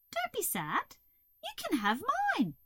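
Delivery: high, sweet caterpillar voice; comforting Hazel. A narrator's reading. Hazel offering the toad her green, comforting.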